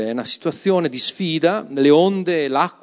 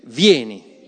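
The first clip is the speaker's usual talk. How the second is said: louder, leading the congregation in saying vieni; spoken together; 2 semitones lower, 5 dB louder than usual. Shown as a demonstration. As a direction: call out vieni firmly with everyone. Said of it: "Diciamo insieme".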